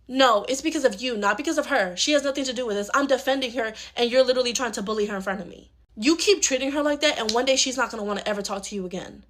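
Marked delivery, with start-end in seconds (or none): none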